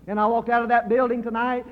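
ordinary talk, nothing marked

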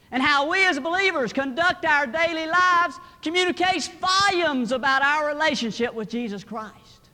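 high, loud, driving pulpit voice; full-force preaching. Real, not acted. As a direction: challenge the congregation at full volume.

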